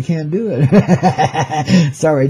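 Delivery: said very slowly through laughter; laughing. Real, not acted. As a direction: laugh the words out. Joking about the Dakotas.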